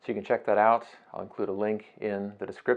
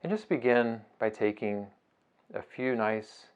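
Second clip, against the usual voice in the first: soft, unhurried meditation voice with long pauses; guided meditation; 7 dB quieter than usual. Acted. Teacher guiding listeners through a beach breathing meditation, soft and unhurried. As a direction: ease them gently through each breath, phrase by phrase.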